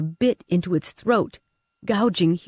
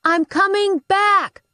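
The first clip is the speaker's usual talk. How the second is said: high, slow call across the fire; shouted promise; 14 semitones higher, 4 dB louder, slower than usual. Acted. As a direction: hold the promise out loud to him.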